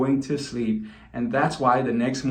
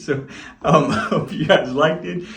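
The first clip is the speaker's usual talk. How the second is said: laughing through the sign-off, a little louder; 3 semitones higher, 6 dB louder than usual. Real, not acted. Vlogger wraps up the story to viewers, laughing. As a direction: giggle while wrapping up.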